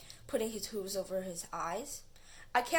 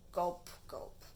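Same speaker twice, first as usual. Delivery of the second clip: fading gulps; 3 semitones lower, 6 dB quieter than usual. Acted. Caterpillar gulping, fading out.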